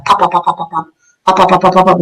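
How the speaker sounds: rattling string of spoken gunshot pops; imitated rapid gunfire. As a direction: mimic a burst of machine-gun fire. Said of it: "I heard immediate rapid gunfire".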